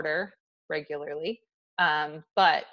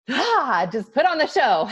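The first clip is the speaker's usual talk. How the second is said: higher, louder burst of put-on cheer; mock bubbly burst; 6 semitones higher, 7 dB louder than usual. Describing the formal event where she must be outgoing, in a hammed-up burst of cheer. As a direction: puff up like you're forcing on a show. Mimicking forced bubbly social mode.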